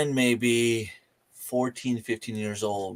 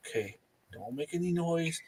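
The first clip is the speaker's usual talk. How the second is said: much softer, tense hush; hushed to himself; 5 semitones higher, 11 dB quieter than usual. Retold or imitated. Boy facing a moose, hushing himself to stay silent. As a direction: hold still and keep it hushed.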